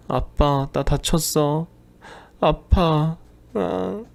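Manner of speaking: put-on a hurt child calling for daddy; crying child. Little daughter phones father in pain, lightly crying. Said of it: "딸아이가 울면서 전화를".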